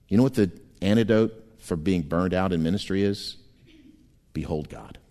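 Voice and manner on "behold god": soft, low and hushed; hushed behold. Real, not acted. Pastor hushes the church before God, softly. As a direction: breathe it to the congregation.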